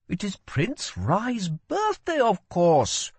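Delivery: put-on a curious young woman asks; girl's voice. A narrator's reading. Girl explains.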